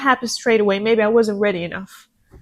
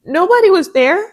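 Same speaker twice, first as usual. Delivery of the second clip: higher and louder, overflowing with feeling; impassioned outcry; 6 semitones higher, 3 dB louder than usual. Real, not acted. Host telling listeners her lonely struggle, higher and louder. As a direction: let it burst out, high and full. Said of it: "I'm getting emotional".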